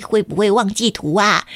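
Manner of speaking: ordinary delivery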